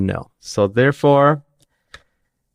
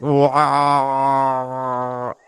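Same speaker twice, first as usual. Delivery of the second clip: bear roar; 4 semitones higher than usual. Retold or imitated. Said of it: "they sound more like bears".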